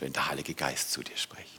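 soft breathy whisper, very low. Acted. Preacher whispering about the Spirit speaking. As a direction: let it fade to a breath.

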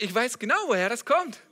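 high, loud, assured answer; emphatic certainty. Acted. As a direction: proclaim it as one who knows. Voices Jesus answering the question.

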